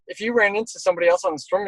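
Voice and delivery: usual speaking voice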